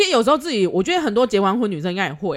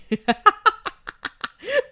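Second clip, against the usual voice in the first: a high laugh that climbs; helpless giggle rising; 13 semitones higher than usual. Real, not acted. Cracking up at the story.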